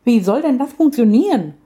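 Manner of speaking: lion cub now curious about the bird. A narrator's reading. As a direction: ask it with sudden curiosity. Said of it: "fragte sie nun doch neugierig".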